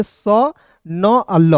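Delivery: "स न अल" slowly, one sound at a time; sounded out separately. Shown as a demonstration. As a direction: break "स न अल" apart with small gaps. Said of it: "इसे पढ़ेंगे".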